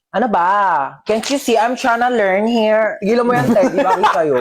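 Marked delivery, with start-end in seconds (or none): none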